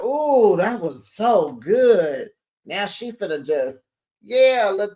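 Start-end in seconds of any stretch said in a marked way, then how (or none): 0.0-2.3 higher, louder "Ooh", then slowed savoring; thrilled gush. Retold or imitated. Host telling besties how she reacted to the finale, thrilled. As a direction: relish it like the best TV. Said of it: "I was like".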